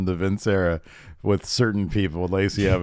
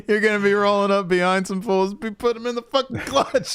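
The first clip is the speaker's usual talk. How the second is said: higher, laughing through the words; laughing mock; 11 semitones higher than usual. Real, not acted. Co-host mocking the Cobra Clutch in battle, laughing through it. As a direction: joke about it while cracking up.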